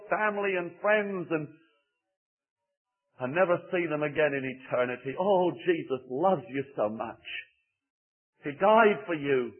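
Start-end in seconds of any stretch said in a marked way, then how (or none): none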